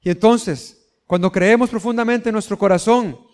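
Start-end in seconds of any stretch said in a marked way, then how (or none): none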